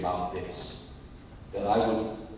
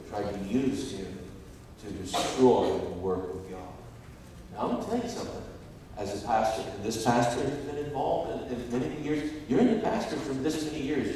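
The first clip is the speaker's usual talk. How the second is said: ordinary talk, nothing marked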